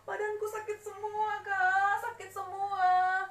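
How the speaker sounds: high loud pained cries; begging through the pain; screaming for help. Acted. Suffering child screams to older sibling for help. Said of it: "si adik terus saja menjerit-jerit".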